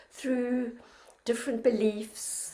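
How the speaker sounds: normal talking voice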